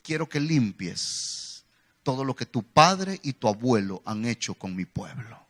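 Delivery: low, solemn divine command; voice of God. Retold or imitated. God commanding Josías to cleanse, solemn and low. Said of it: "Dios le dice a Josías".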